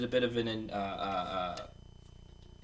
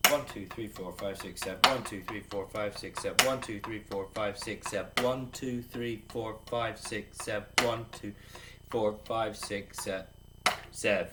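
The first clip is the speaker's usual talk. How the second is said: steady slow count aloud; 3 semitones lower than usual. Shown as a demonstration. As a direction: count the strokes evenly as you play. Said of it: "right hand lead".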